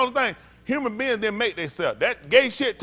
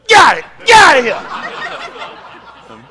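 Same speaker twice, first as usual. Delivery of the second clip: loud drawn-out hammed-up yell, half laughing; mock shout; 6 semitones higher, 13 dB louder, much slower than usual. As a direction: chase them out with a drawn-out laughing bellow. Acted chasing out, shouting.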